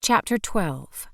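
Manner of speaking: slow, announced heading; chapter heading. A narrator's reading. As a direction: read the title deliberately.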